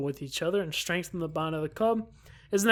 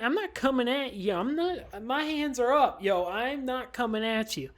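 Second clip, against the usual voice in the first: ordinary talk, nothing marked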